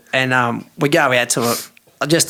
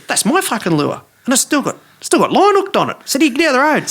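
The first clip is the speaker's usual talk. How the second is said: pitched-up thrilled outburst; excited disbelief; 11 semitones higher than usual. Retold or imitated. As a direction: shout it in delight as you spot your lost lure. Excited discovery of own lure.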